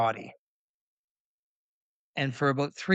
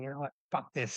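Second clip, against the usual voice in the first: softer, muttered aside; retold inner thought; 10 dB quieter, faster than usual. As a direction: mutter it to yourself, fed up. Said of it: "and thinking".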